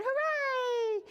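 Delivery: high joyful hooray; cheering. Acted. Farmer cheering the thieves away, high hooray. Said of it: "the farmer cheered".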